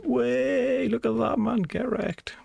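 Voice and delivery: high excited whoop of success; excited cheer. Real, not acted. Streamer cheering when the counter finally works. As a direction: crow over the win.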